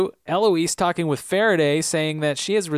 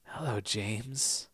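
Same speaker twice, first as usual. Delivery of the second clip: much softer, slow, deliberate greeting; mock creepy; 4 semitones lower, 12 dB quieter, much slower than usual. Faraday greeting Sawyer at the door, slow and hushed.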